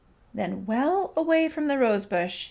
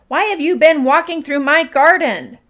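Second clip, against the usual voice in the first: high, very loud, fierce demand; 6 semitones higher, 12 dB louder than usual. A narrator's reading. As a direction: confront the intruder boldly. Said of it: "said Ernest fiercely".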